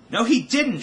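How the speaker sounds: very high, loud furious scream. A narrator's reading. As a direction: turn grief into rage and scream. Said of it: "she screamed. Her sadness had turned to fury".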